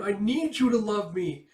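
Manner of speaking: high, loud, slow sobbing plea; tearful plea. Retold or imitated. Crying child begging her mother, loud tearful plea. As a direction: beg through tears for love. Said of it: "poor Perry's crying".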